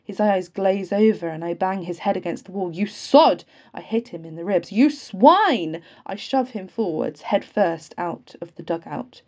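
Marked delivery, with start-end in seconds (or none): 2.7-3.4 loud curse thrown with a blow; explosive, furious outburst; angry shout. A narrator's reading. Narrator attacks the man, cursing. 4.7-5.8 loud curse, swooping up high; enraged, high-pitched bellow; furious shout. A narrator's reading. Narrator attacks the man, cursing.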